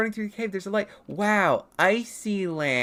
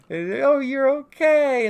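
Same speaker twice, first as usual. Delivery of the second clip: high, loud, drawn-out exclamation; hammed-up high cry of relief; mock relief; 10 semitones higher, 10 dB louder, much slower than usual. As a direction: play exaggerated relief, slowly. Acting out character finding Penta okay.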